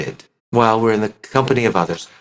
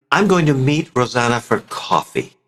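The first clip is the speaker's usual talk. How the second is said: ordinary talk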